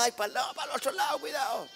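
very high, alarmed mock cry; hammed-up panic in a high voice; acted panicked warning. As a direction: warn them as if the boat is tipping. Acts warning in tipping boat.

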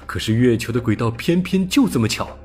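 ordinary delivery, nothing marked

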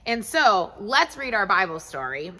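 normal talking voice

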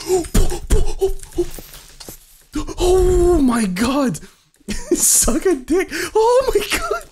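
high, loud cries of fright; panicked screaming. Real, not acted. Player chased by monsters, panicked screaming. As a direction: shriek and gasp in panic.

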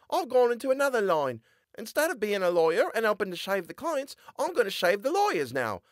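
put-on high boyish voice; a lively lad answering; young boy character voice; Tobias, boyish voice. A narrator's reading.

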